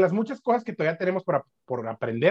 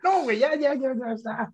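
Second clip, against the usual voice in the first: squeamish; 9 semitones higher than usual. Real, not acted. Reacts to gory story.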